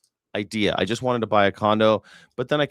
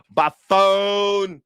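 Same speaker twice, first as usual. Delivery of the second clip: high, louder drawled "phone"; mock Southern drawl; 9 semitones higher, 5 dB louder than usual. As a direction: ham up the Southern anchor. Mocking the anchor's drawl.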